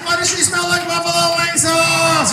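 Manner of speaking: high, desperate pleading shout; panicked yelling. Retold or imitated. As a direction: yell like you're being crushed. Pinned friend begging for help.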